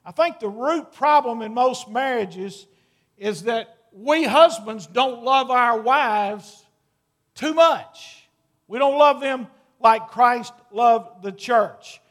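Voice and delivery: slow, loud, driving sermon voice; forceful preaching. Acted. Pastor preaching to husbands about loving wives, loud and slow. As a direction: press the point home to the men.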